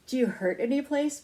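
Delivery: anxious. A narrator's reading. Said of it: "Missus Gridley asked anxiously".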